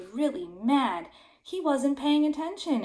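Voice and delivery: usual speaking voice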